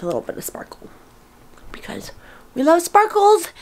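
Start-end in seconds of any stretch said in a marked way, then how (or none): 2.5-3.5 high, loud burst of delight; real excited exclamation; excited gushing. Real, not acted. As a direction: gush it brightly, pitched way up. Gushing over sparkly makeup.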